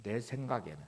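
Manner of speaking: ordinary talk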